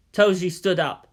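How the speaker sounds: plain reading voice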